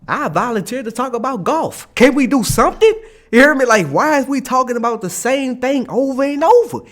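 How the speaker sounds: high, rising fed-up outburst; exasperated plea. Retold or imitated. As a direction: plead for any other topic, loud and high. Acted plea to change subject.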